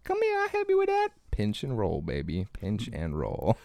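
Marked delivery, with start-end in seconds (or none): 0.0-1.1 very high, oily mock voice; creepy high voice. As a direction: lure the kid in a falsetto. Creep voiced in comedy bit.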